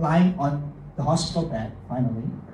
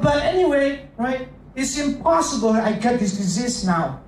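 put-on higher, indignant protest of a young man who refuses the diagnosis; stubborn patient in denial; 6 semitones higher than usual. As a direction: pitch it up as a patient insisting he can't be sick. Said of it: "But Rudy says".

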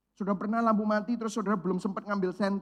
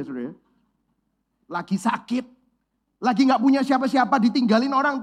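ordinary delivery

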